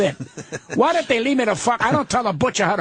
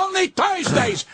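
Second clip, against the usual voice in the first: indignant shout; 11 semitones higher than usual. Real, not acted. Angry rant about comic's billing.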